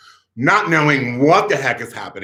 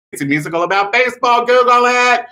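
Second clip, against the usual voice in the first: insistent aside; 10 semitones higher, 5 dB louder, faster than usual. Acted. Mock-insistent aside to the audience.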